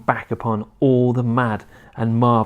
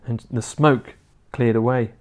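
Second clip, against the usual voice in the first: usual speaking voice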